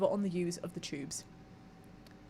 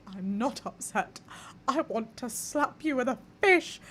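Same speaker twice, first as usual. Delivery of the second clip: mock angry retort; 3 semitones higher, 4 dB louder, slower than usual. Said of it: "and I'm like".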